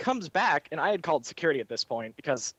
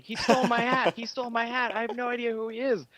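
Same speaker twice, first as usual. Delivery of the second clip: retold scream; 7 semitones higher than usual. Theme park girl yelling at the ride operator, mild mock scream. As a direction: imitate a panicked girl, mildly. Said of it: "starts screaming at me".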